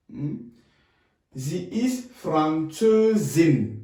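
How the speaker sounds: ordinary talk, nothing marked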